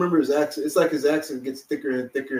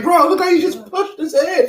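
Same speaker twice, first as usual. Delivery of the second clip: laughing; 7 dB louder than usual. Retold or imitated. Retold reaction laughing.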